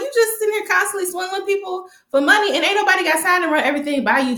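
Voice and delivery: high, fast, scolding clapback; high sassy retort. Retold or imitated. Viewer sassing Jason at the screen in a high fast voice. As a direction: snap back at him, way up high.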